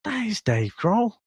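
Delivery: high-pitched, hushed amazement; double-take disbelief. Retold or imitated. Host reliving the moment he spotted [name], stunned. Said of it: "I'm doing a double take and I'm like".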